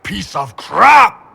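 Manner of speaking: shouted, drawn out, loud and high; slow furious shout. Acted. Bull slowly shouting at the nightmare demon while being struck. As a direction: yell it out to purge the fear.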